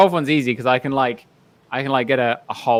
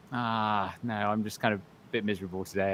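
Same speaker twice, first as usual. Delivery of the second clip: mopey and softer, sighing it out; mock sad boy; 4 semitones lower, 9 dB quieter than usual. Rider brushing off a fan, mock mopey and soft. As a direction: play up the gloomy misery. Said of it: "He turns on his sad boy".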